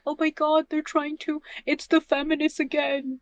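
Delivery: high-pitched mock outrage; outraged complainers. Panelist imitates gamers upset over politics, high mock outrage. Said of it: "They're just like".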